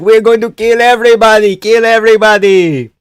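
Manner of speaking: high, loud hammed-up battle rally; mock war cry. Fighter hyping up the troops, in a hammed-up high yell. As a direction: play a fighter riling up the troops, way over the top. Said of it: "He's like".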